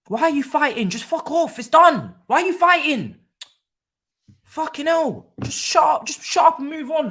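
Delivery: high, loud, exasperated shouting; furious yelling. Real, not acted. Fan shouting at bickering players on screen, high and loud. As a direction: yell at them to stop fighting.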